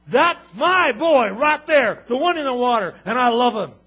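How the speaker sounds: huge, slow proud shout; booming voice of God. Acted. God calls down over his baptized son, proud and booming. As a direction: proclaim it like God from heaven.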